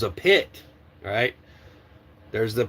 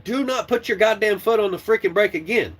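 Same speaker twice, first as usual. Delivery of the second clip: raised, heated voice barking orders at a trainee; angry yelling; 7 semitones higher than usual. Retold or imitated. Trainer yelling at trainee to release the brake, angry. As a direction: yell at him to get off the brake. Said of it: "I said".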